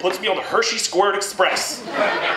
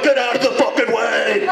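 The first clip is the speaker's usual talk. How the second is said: pushy sperm. Retold or imitated. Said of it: "just like".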